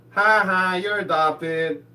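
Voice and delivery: loud sing-out taunt, drawn out at the end; loud mocking "ha ha" jeer. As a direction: imitate a brother gleefully teasing. Brother taunts him in retold scene.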